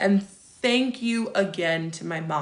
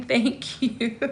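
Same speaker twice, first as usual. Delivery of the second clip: laughing thanks; 4 semitones higher, 5 dB quieter than usual. Real, not acted. Touched thanks to a fan, laughing.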